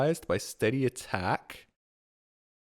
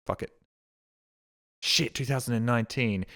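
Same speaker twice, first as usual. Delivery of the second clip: normal talking voice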